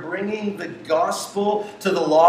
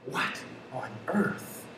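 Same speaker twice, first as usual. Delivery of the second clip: whisper; 13 dB quieter than usual. Real, not acted. Stunned aside after verdict.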